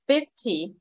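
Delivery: Fifty as FIF-tee, falling off; Fifty with the first syllable prominent; stress on first syllable. Shown as a demonstration. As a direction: put the weight on the start of Fifty. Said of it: "nhấn trọng âm số một".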